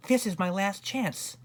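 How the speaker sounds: high-pitched urgent self-talk; desperate boy. A narrator's reading. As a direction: say it anxiously, pitched well up.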